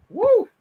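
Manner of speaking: high sudden whoop; excited whoop. Real, not acted. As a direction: let out a spirit-caught woo. Feels the spirit arrive.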